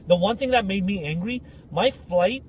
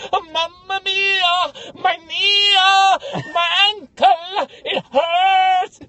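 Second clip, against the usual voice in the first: high, loud mock-operatic wailing; Italian opera drama queen; 10 semitones higher, 6 dB louder, much slower than usual. Injured Italian guy milking his ankle, operatic wail. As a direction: wail each phrase long like a hammed-up Italian tenor. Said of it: "becoming the biggest Italian ... drama queen. Like, he's in an opera".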